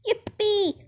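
high-pitched whoop of joy; shouted glee. A narrator's reading. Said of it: "shouted Grandpa Joe".

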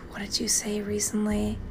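breathy whispered question. Real, not acted. Whispering a pointed question to Elena.

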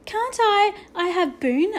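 put-on high, pleading child's voice; small voice. A narrator's reading. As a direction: ask small and high, hoping. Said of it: "asked Patrick in a small voice".

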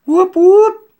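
high loud police siren imitation; siren whoop. Police arriving, loud high siren imitation. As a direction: blare it like a cop siren.

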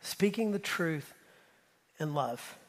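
soft echo of the verse; gentle repeat. Shown as a demonstration. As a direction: say it tenderly this time.

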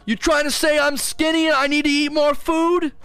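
high-pitched, hammed-up indignation; outraged customer. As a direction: play a customer absurdly furious over free fries. Acts out outraged Wendy's customer.

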